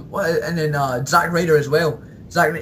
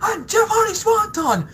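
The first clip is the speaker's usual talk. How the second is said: mock commentator call; slower than usual. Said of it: "It's like".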